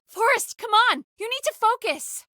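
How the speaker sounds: high, pressing urge to focus; urgent plea. Acted. Co-host pressing Forrest to focus during the crisis, urgent and high. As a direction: jolt your partner awake.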